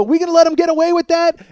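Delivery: high, loud rhetorical shout; rousing crowd call. Retold or imitated. Rappers asking fans to judge the guard, rousing crowd call. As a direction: whip up the audience against him.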